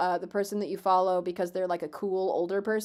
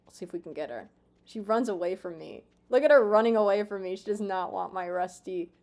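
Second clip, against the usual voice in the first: usual speaking voice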